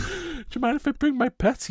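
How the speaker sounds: high-pitched pretend princess; mock Cinderella. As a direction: ask sweetly in a put-on high voice. Voices Cinderella asking the king.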